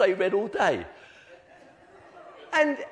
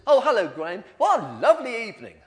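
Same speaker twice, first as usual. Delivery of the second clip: louder, beaming hello on the phone; cheery greeting; 4 semitones higher, 8 dB louder than usual. Retold or imitated. Nephew answering Graham's phone call, cheerfully loud. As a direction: greet like the evening is glorious. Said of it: "He says".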